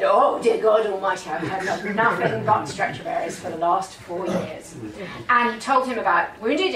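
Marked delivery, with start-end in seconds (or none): 0.0-4.8 louder outburst of comic exasperation; mock exasperation. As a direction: play the long-suffering husband, lightly hammed up. Husband fed up with stretcher bearers.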